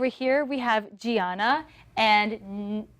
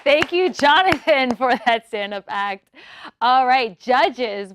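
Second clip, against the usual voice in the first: excited host; 2 semitones higher, 7 dB louder than usual. Acted. Host cheers loudly.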